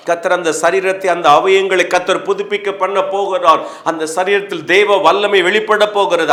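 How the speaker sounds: loud, insistent prophetic declarations; forceful preaching. Acted. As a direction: proclaim promises with mounting fervour. (உரத்த, எழுச்சியான பிரசங்கக் குரல்)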